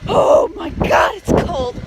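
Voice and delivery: high, slow shriek in the cold. Real, not acted. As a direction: cry out at the cold. Running in freezing snow.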